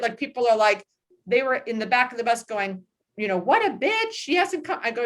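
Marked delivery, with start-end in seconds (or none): 3.4-4.8 high, catty mock gossip; snide gossip. Passengers at the back of the bus griping about the woman, catty mock gossip. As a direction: imitate the passengers sniping about her.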